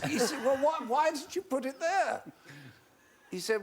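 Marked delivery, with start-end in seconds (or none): 0.1-2.2 higher indignant, voice raised and put-on; mock indignant Eric. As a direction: play Eric affronted at the bomb. Eric's indignant retort voiced.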